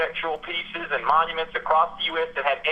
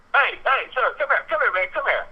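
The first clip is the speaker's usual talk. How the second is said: sheriff calling from behind, much higher; 10 semitones higher than usual. Retold or imitated. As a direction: call him back across the lot, raised and high. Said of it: "and I hear".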